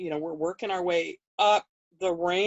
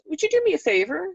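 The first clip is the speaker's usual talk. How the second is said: sweet request; 9 semitones higher, faster than usual. Retold or imitated. Friend asking a favor, retold.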